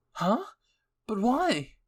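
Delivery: a shocked, hurt inner huh; surprised. A narrator's reading. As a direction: caught off guard by rejection. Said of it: "I thought".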